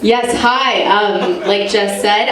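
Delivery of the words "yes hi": normal talking voice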